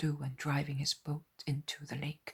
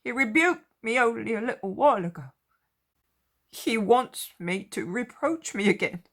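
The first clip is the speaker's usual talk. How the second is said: higher, louder, anxious apostle voice; ashamed Peter; 7 semitones higher, 10 dB louder than usual. A narrator's reading. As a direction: voice Peter's shame, a bit higher. Peter ashamed before meeting Jesus.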